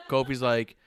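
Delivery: ordinary talk, nothing marked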